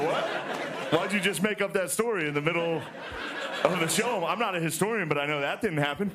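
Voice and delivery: high-pitched, baffled protest; incredulous objection. Acted. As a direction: call out a blatant fib in disbelief. Incredulous reply to the historian's story.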